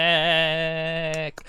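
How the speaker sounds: slow robotic cheer. Acted. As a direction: stretch it out like a pleased robot.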